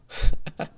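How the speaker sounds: brief, loud chuckle; a quick burst of laughter before giving in; one short scoffing laugh. Acted. Laughs before agreeing to join.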